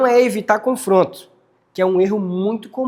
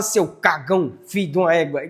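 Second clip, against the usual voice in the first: scolding tone; 2 semitones lower than usual. Shown as a demonstration. Same words to the dog, now scolding. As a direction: say the insults as a real telling-off, mildly. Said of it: "mesma palavra".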